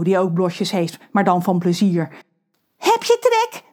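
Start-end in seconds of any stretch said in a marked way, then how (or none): 2.8-3.6 high sing-song call to the sea. Acted. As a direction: shout down to the shark. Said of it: "roept hij richting het water". (roepend naar het water)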